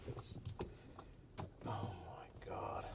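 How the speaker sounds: slow whisper of despair. Real, not acted. Worn-out driver whispering in despair in the jam. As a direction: breathe it out, defeated. Stuck in chaos.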